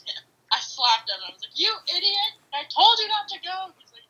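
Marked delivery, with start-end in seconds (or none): 1.6-3.7 much higher, exasperated retold scold; angry scolding. Girlfriend scolding boyfriend after the cop stops them, high angry scold. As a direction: snap at him like a furious girlfriend.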